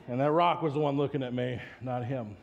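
ordinary talk

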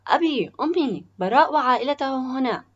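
high, loud call into the house. A narrator's reading. Son at the front door calls his parents, calling out. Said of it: "نادى ليث بعد أن فتح الباب".

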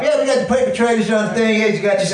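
Irish cop. Retold or imitated. Boy copying his idol's police routine, mock Irish cop. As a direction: put on a comedian's Irish cop character. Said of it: "his Irish cop".

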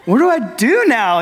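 high, laughing, unsure what next; the pastor recalling his own awkward joy, laughing high; laughing awkwardly. Retold or imitated. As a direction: laugh through it, half overwhelmed. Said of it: "you're just kind of like".

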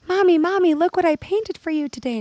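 put-on eager kid showing off a painting; excited child voice. Child running to mom with a painting, high excited kid voice.

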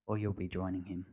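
quiet threat to a panicking friend; quietly. A narrator's reading. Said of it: "said John quietly".

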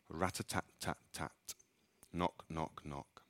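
very quiet, fading knocks; soft knocking. A narrator's reading. Narrator tapping out the demon's quiet knock, very soft. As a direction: tap it out softly, almost nothing.

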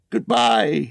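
high-pitched happy goodbye; cheerful farewell. Acted. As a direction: bid a joyful goodbye. Bilbo bids his home farewell.

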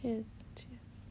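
repeated toast dying away to a whisper; fading cheers. Pretend. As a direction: let each one shrink until it's barely whispered. Repeated thanks trailing into a whisper.

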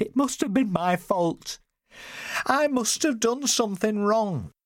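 high, slow, agitated self-blame; worked-up mother, pitched very high. A narrator's reading. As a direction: blame yourself in a high, drawn-out wail. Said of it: "She grew agitated".

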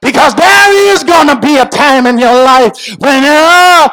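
high, impassioned sermon cry; full-force preaching. Real, not acted. Preacher warning congregation about hard times, preaching at full force. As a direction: drive it hard, stretching the key words.

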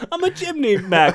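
a silly high Mary Poppins cockney; chirpy chimney-sweep accent for laughs; mock cockney. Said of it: "You're Mary Poppins now".

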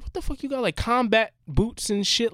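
high-pitched incredulous question; retold astonishment. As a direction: replay own disbelief at the odd outfits. Retold shock at odd outfits.

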